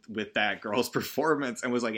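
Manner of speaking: ordinary talk, nothing marked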